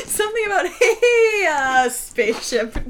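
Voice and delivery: quoting through high laughter; laughing. Real, not acted. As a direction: giggle out the quote. Laughing imitation of teammate.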